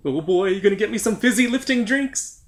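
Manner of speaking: high cartoon voice; squeaky excited kid voice; high goofy voice. Voicing robot, Wonka reference.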